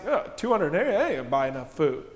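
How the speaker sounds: mock sarcastic shrug of a voice, lightly voiced. Philip answering Jesus with a mild sarcastic brush-off. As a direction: toss it off as a half-hearted joke.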